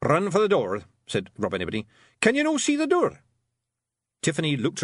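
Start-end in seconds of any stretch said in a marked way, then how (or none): 0.0-3.2 raised gruff little Scots pixie, pleased with himself; boastful wee Feegle, a bit louder; Rob Anybody's Scots voice. A narrator's reading. Rob Anybody boasting to Tiffany after the fight, Scots pixie voice. Rob's voice.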